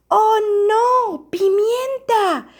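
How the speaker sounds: high, loud, drawn-out storybook alarm; alarmed exclamation. A narrator's reading. Alarm as pepper reaches the bear.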